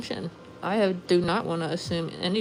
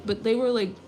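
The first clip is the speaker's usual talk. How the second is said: ordinary talk, nothing marked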